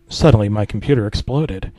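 plain reading voice

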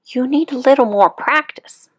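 high little mouse voice; a mouse giving gentle advice, pitched high; squeaky mouse. Acted. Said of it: "said the mouse".

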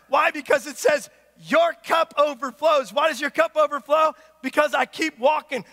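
emphatic preacher, voice up; full-force preaching. Acted. Preacher telling the congregation of unconditional love, full-force preaching. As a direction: drive it home to the congregation.

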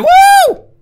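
a very high, loud cheer; excited whoop. Real, not acted. Gamer nailing a jump lets out a high, loud whoop.

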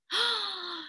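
drawn-in storyteller's gasp; theatrical gasp. Acted. Storyteller building up to the sneeze, theatrical gasp. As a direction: suck in a big audible breath.

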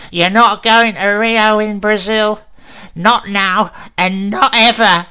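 high, loud crone, laughing through it; cackling witch. Acted. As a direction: gloat like a wicked witch. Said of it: "cackling and spitting".

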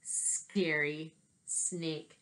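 normal talking voice

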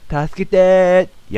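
long, loud, high mock wail of help; teasing sing-song cry, drawn way out; drawn-out call for help. Player mimics the cry for help.

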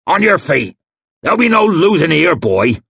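loud, high, fast yell; yelling father. Acted. Crummock yells at his fallen son, loud fast roar. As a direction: roar at the boy to stand.

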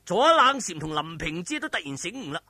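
plain reading voice

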